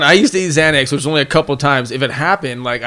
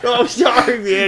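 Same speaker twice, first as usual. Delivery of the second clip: high hammed-up sorry; mock high apology; 9 semitones higher, slower than usual. Golfer to the man hit, mock high-pitched apology. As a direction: pretend to call out an apology, pitched up.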